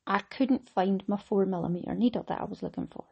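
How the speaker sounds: normal talking voice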